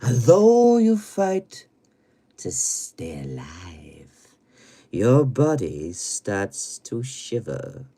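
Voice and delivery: slow, ominous low intoning with sudden swells; drawn-out macabre verse; spooky horror recital. Pretend. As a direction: recite like a horror-film host, dropping to whispers.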